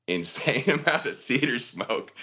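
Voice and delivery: high, amused telling through laughter; laughing. Real, not acted. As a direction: laugh through the words as you recall it. Laughing while retelling own puking.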